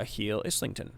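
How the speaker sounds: ordinary talk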